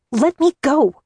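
high desperate scream; shouting. A narrator's reading. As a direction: yell to be let go. Struggling against Lantos, hysterical.

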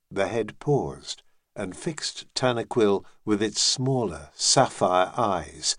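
usual speaking voice

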